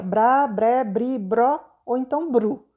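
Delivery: "brá, bré, bri, bró, bru" each said apart and clearly; syllables sounded out. Shown as a demonstration. Teacher reads "brá, bré, bri, bró, bru" to the class, measured. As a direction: run through the syllables one by one. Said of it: "vai ser uma dessas sílabas aí".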